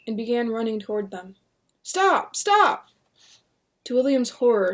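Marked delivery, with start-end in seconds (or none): 1.8-2.9 high loud shouts; shouting. Acted. William running toward the stakes, loud shouts. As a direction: yell at them to stop.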